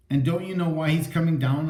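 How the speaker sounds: plain reading voice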